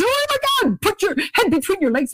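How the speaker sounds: high, mock-panicked cry on a roller coaster. Rider on a scary roller coaster, hammed-up panic. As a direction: squeal it like the coaster is dropping. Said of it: "'cause you're so scared".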